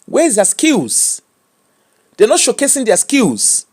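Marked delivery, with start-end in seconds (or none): none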